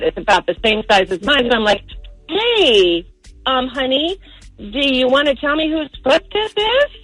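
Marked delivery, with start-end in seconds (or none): none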